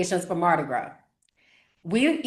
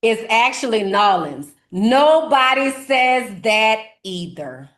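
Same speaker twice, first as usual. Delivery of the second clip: emphatic correction; 4 semitones higher, 6 dB louder than usual. Real, not acted. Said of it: "Only tourists say Nolans".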